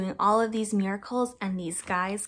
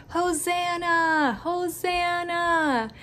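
gentle cheer; 6 semitones higher, much slower than usual. Acted. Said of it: "they yelled, and they shouted".

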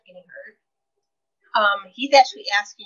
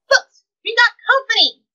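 much higher, louder, mock-urgent call; alarmed warning; 12 semitones higher, 5 dB louder than usual. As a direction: sound the alarm for the team. Matt warns of Switchblade.

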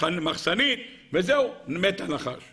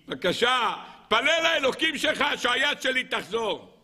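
mildly acted, higher-pitched begging; pleading; 6 semitones higher than usual. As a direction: beg the prophet to pray for you. Voices king begging prophet. (תחינה מוצגת בקול גבוה)

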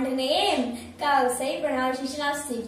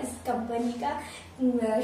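ordinary delivery

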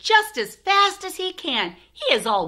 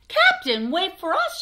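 high, eager call; children shouting; 9 semitones higher, 4 dB louder than usual. Acted. Children calling the captain to wait, mild high shout. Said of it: "shout the children".

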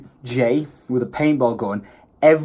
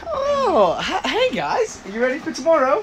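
giddy hype; 14 semitones higher than usual. Real, not acted. Hyping up friends, laughing.

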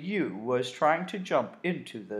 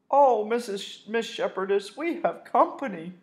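put-on higher, lilting girl's voice; sweet polite greeting; 9 semitones higher, 4 dB louder than usual. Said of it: "Betsy greets the wolf politely".

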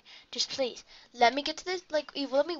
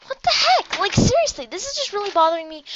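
loud, higher, exasperated rant; frustrated outburst; 7 semitones higher, 9 dB louder than usual. Real, not acted. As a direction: vent at the game. Angry at level.

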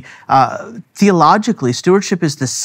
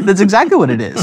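laughing; 6 semitones higher, 3 dB louder, faster than usual. Real, not acted. Laughing agreement.

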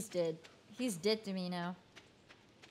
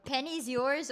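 ordinary talk, nothing marked

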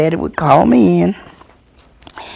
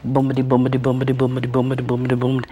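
tricycle sound; 4 semitones lower, much faster than usual. Retold or imitated. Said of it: "The tricycle sounded like".

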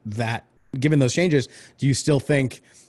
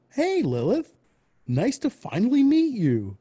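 high, sugary fake-friendly greeting; really nice; 12 semitones higher than usual. Villain greeting Lily with fake sweetness, high and sugary. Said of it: "really nice and be like".

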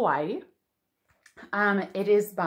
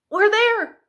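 excited arrival; 12 semitones higher, 6 dB louder than usual. Pretend. Arriving in Hawaii, playacting.

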